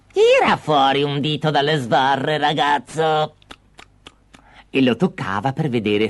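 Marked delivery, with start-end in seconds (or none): 0.1-3.3 put-on old witch giving an order; commanding witch. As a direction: order the captive boy about in a witch voice. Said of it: "guardando dentro gli diceva". (voce di strega imperiosa)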